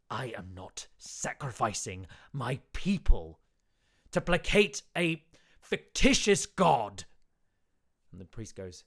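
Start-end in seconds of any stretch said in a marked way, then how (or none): 0.1-7.0 rising to a loud angry retort; angry refusal. Acted. Town speaker angrily refusing the priest's demand for sacrifice. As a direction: build to a furious, louder protest.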